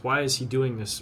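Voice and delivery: usual speaking voice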